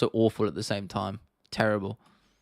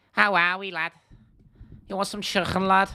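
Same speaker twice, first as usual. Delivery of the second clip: high-pitched comic Scouse; Scouse accent; 7 semitones higher than usual. Shown as a demonstration. Streamer doing a requested impression, high Scouse voice. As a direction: put on a high Liverpool accent. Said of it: "Scouse impression".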